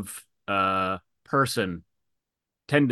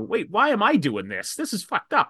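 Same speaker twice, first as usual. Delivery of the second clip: higher, stunned inner voice; incredulous realisation; 9 semitones higher than usual. Retold or imitated. Woman voicing her disbelief while consoling her abuser, higher and appalled. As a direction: snap out of it, appalled, pitched up. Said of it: "inner voice, sudden realisation".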